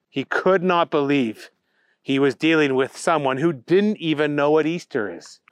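ordinary delivery, nothing marked

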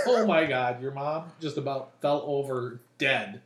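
normal talking voice

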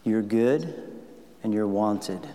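soft, slow words of blessing; tender blessing. Shown as a demonstration. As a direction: say it gently to her face. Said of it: "I'm saying this to you".

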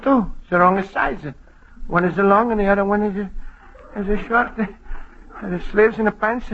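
ordinary talk, nothing marked

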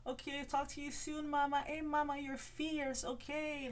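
high, sassy put-on voice calling everyone mama; mock Ramona voice. Mimicking a housewife's high sassy goodbye to a friend. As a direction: camp it up like a diva saying goodbye.

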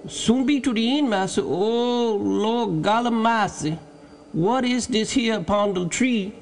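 high-pitched dialect voice, breaking into a drawn exclamation; Jupiter, startled servant. A narrator's reading. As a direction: cry out at a strange discovery. Jupiter finds something startling.

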